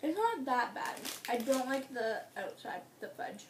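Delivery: chewy food in mouth; talking while chewing. Real, not acted. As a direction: mouth partly full of candy. Seen 2: Left speaker bites at -2, talks with food, chewing during words.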